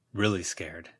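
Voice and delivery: ordinary delivery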